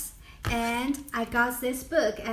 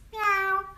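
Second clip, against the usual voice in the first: high, short mewing meow; a quick kitten mew; Swedish cat meow; 8 semitones higher than usual. Shown as a demonstration. Showing viewers the Swedish cat sound, short high mew.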